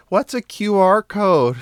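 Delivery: put-on high-pitched small-child voice; very little kid. Really little child questions Poppy, high kid voice. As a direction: ask it as a puzzled little kid. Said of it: "the really little ones".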